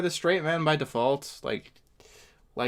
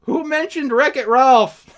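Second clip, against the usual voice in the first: baffled; 8 semitones higher, 6 dB louder than usual. Real, not acted. Baffled by the clip's non sequitur.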